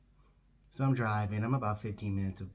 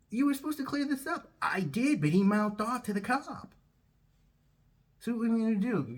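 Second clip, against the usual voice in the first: high, pitched-up mock panic; a hint of a frantic caller; 14 semitones higher, 4 dB louder than usual. Game show staffer panicking on the phone, high voice. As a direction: play the caller fretting, voice high.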